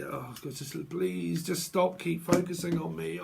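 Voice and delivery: ordinary delivery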